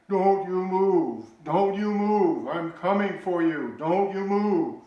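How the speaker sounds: high, loud, slow commanding call. Retold or imitated. Brother leans out of the stadium to hold lost boy still, high loud slow call. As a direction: hold him in place from across the crowd.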